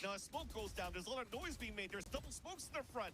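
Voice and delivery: high, lively play-by-play call; esports caster. Acted. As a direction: call the action fast and bright. Lively play-by-play over the action.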